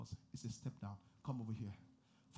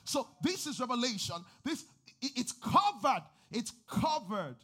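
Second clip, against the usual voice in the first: loud, higher preacher's push; fervent preaching; 7 semitones higher, 7 dB louder than usual. Acted. Pastor driving home what revelation is, louder. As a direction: drive the point home to the church.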